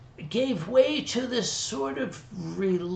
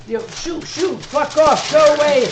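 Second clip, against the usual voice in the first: shooing a roach; 7 semitones higher, 7 dB louder than usual. Retold or imitated. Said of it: "and I'm like".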